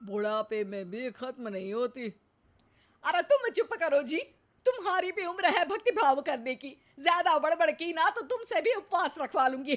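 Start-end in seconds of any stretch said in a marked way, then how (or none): 3.0-9.8 higher, louder and fast, snapping at him; fierce scolding. Acted. Wife turning furious on her teasing husband, sharp scolding. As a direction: tell him off sharply to shut him up. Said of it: "रौद्र रूप क्यों धारण कर रही हो".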